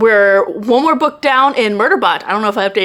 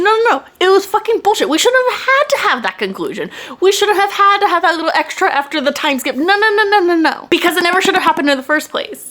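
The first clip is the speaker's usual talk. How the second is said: higher-pitched heated rant, insistent no's; angry rant; 8 semitones higher, faster than usual. Real, not acted. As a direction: vent your fury at the ending, pitched up. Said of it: "I'm so angry".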